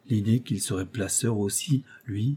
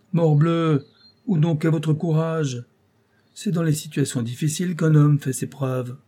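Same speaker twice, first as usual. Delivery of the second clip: higher, forceful scolding; rousing rebuke; 6 semitones higher, 3 dB louder than usual. Acted. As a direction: rouse him with a raised, insistent voice. Placeur shakes Paul, rebukes him.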